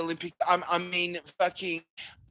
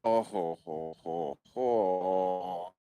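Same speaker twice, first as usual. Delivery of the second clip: deep mock chuckle; 10 semitones lower, slower than usual. Mock villain laugh.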